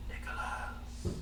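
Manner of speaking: breathy ghostly call from the dark; eerie whisper. Acted. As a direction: whisper the name slowly, luring. GM voices eerie cave whisper.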